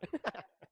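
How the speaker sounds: chuckling at the absurdity; a short burst of laughter; amused laugh. Real, not acted. Teacher laughing at the pork rumour he tells, amused.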